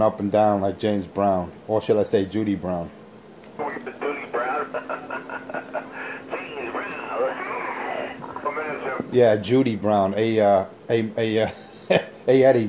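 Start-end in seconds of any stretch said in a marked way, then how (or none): 3.6-9.1 long high chuckle at his own joke; a drawn-out amused laugh. Real, not acted. Cracking up at his own Judy Brown jab, long laugh.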